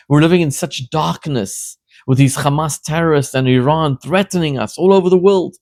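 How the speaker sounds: usual speaking voice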